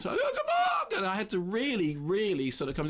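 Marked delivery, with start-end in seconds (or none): 0.0-0.5 high, playful mock voice of the actress; Linda Blair. Actress teasing the flustered young interviewer, high mock voice. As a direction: toss it back in a teasing high pitch. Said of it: "And she went".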